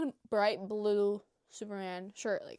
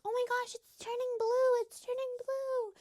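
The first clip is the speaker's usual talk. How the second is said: put-on childish excitement; 5 dB louder than usual. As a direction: gasp like a kid watching magic happen. Kids thrilled by fake potion.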